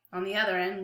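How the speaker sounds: usual speaking voice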